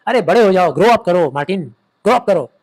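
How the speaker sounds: loud, angry father's telling-off; scolding. Acted. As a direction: tell your son off loudly. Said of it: "डांट के बोले". (डाँट भरी ऊँची आवाज़)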